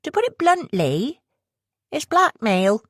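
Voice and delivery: high, blunt West Country reveal; sly West Country woman. Acted. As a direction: drop the word with relish, West Country accent. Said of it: "Caroline laughed in an off-putting fashion".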